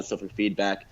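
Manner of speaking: usual speaking voice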